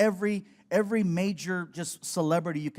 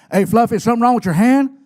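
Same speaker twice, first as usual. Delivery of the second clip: gruff shout; 5 semitones higher, 14 dB louder than usual. Retold or imitated. Stone Cold demanding drink.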